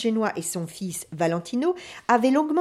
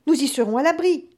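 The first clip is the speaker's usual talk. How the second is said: bellowing over the wind; high, loud hopeful shout; yelling; 7 semitones higher, 4 dB louder than usual. A narrator's reading. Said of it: "hurla Valentino".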